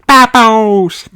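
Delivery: put-on high, loud toddler squeal; little brother's happy cry; excited cry. A narrator's reading. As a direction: squeal it with delight. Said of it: "cries George".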